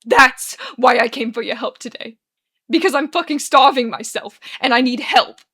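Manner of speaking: loud desperate outburst; barrelling on, distraught. A narrator's reading. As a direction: barrel on at full volume. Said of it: "but Arthur barrels on".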